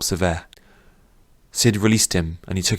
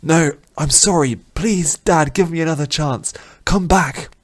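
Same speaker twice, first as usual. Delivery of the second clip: much higher, begging through tears; tearful pleading; 9 semitones higher than usual. Acted. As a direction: plead desperately for another chance. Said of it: "Hot tears ran down Rin's cheeks".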